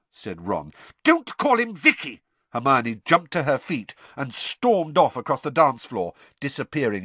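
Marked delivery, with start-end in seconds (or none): 1.0-2.2 high, loud outburst; exploding with indignation; furious shout. Acted. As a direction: yell it, at breaking point. Hermione explodes at Ron's taunt.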